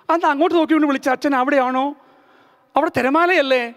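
ordinary delivery, nothing marked

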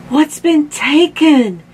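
mild gasp of alarm, drawn out; gasping mom. A narrator's reading. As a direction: ask it as a mildly shocked mom. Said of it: "gasped Mom".

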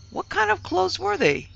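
very high-pitched demand; insistent questioning. A narrator's reading. Mistress pressing maid for details, insistent. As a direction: press for an answer, pitch leaping up.

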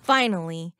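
usual speaking voice

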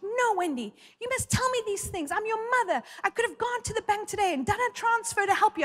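a hint of a fretting mother; scolding mom.

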